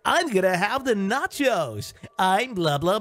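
a hint of DJ sing-song, slow; child mock-ordering in DJ voice; mimicking radio voice. Child ordering nachos, lightly mocking DJ voice. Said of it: "in that voice".

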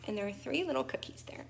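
plain reading voice

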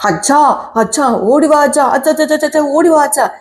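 high, urgent calls for dad; excited calling. Acted. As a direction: shout for dad to come running. Son runs home calling father. (ആവേശത്തോടെ ഉച്ചത്തിൽ അച്ഛനെ വിളിക്കുന്നു)

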